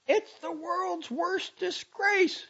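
high, loud, drawn-out outrage; angry condemnation. A narrator's reading. Girl condemning the doctor's book, outraged. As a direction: cut in, stressing every word.

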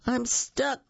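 a mild cry for help. Acted. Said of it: "cried Hippo".

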